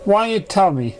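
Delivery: hurt, lightly angry question; mild anger. A narrator's reading. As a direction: scold gently but with heat. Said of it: "Twice angrily".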